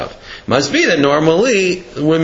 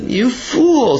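exasperated scolding; 7 semitones higher than usual. Acted. Lion father scolds his son.

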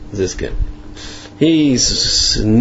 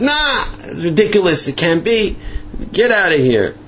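high-pitched, mock-indignant brush-off; acted-out irritated refusal; scornful dismissal; 8 semitones higher than usual. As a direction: send the messenger packing. Retold Ziskin dismisses messenger.

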